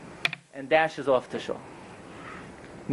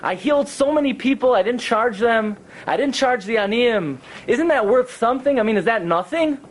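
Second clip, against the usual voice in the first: high, insistent mock protest; imitated desperate appeal; screaming and crying retold; 6 semitones higher than usual. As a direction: plead your case in a raised pitch, no real sobs. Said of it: "he starts screaming and crying".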